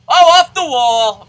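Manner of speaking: loud, high, stretched-out cry; excited shout. Real, not acted. Player reacting to a hit in the game, excited shout. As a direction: holler it as the ball hits.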